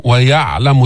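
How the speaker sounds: "ويعلم" with the ayn held still, no vowel. Shown as a demonstration. Teacher shows the unmoving ayn in "ويعلم". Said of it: "سكن العين ما تحركها".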